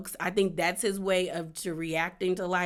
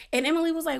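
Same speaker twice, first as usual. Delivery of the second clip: ordinary talk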